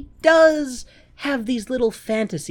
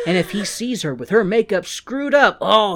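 ordinary delivery

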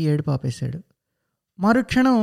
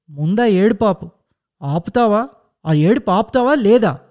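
louder sharp threats to stop crying; angry yelling; 3 semitones higher, 5 dB louder than usual. Acted. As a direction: shout him into silence. Mother yells at crying son. (ఏడుపు ఆపమని కోపంగా అరుపు)

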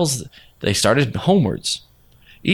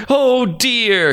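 "Oh" wailed much higher with a falling cry; wailing; 11 semitones higher than usual. A narrator's reading. Grieving farmer wails over his wife, high falling wail. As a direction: let "Oh dear" break upward into a grieving wail. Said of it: "he wailed aloud".

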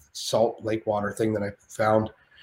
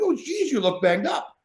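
higher, lightly put-on De Niro. De Niro remarking on Shane's face, lightly put-on. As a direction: tease him as De Niro. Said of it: "He's like".

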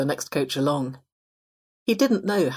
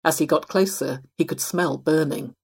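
ordinary delivery

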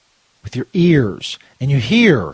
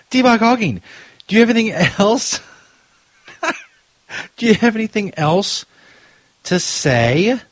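laughing; 8 semitones higher than usual. Real, not acted. Laughing while asking the bot.

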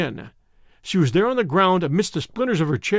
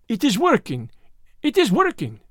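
high-pitched, strung-tight Frenchman; Frenchman's taut cry of success; excited cry; 5 semitones higher than usual. A narrator's reading. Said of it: "cried the Frenchman, and there was a crack in his voice".

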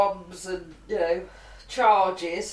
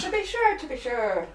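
high Irish lilt on to be sure; jokey Cork Irish accent; 4 semitones higher than usual. Pretend. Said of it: "That's a Cork accent, [name], that you just did".

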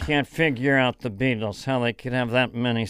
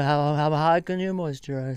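usual speaking voice

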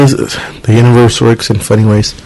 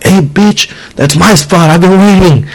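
higher-pitched, indignant retold complaint; mock angry driver; 7 semitones higher than usual. Driver confronting someone over a parking spot, mock angry. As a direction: play an irate driver, without really shouting.

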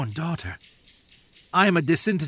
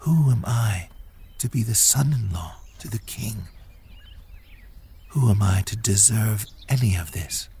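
hushed, half-whispered questioning; quiet prayer. Acted. David alone asks the Lord why he deserves this, quiet. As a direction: ask it humbly, under the breath. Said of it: "David spoke quietly to the Lord".

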